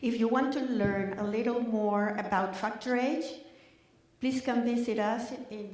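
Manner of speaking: ordinary delivery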